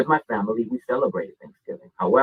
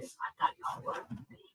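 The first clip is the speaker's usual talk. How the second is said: unvoiced whisper, inner voice; whispered thought; 15 dB quieter than usual. Thinking to himself while tattooing the Apache, whispering. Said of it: "thinking in my head".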